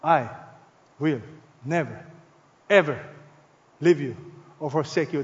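low, word by word with long pauses; solemn promise. Acted. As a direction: give "never" and "ever" each its own space. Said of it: "But God gives you a promise".

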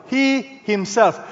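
"He himself" slow, both words weighted; emphatic. Shown as a demonstration. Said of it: "This is what we call emphatic".

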